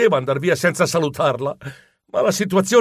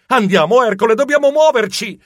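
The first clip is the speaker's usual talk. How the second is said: higher, pressing call to move; urgent; 6 semitones higher, 3 dB louder than usual. Acted. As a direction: urge your friend to escape. Urging friend to flee. (incitamento urgente a fuggire)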